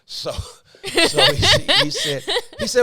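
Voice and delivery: high, breaking into laughter mid-sentence; can't get the words out for laughing. Real, not acted. Pastor laughing as he retells the man's reply.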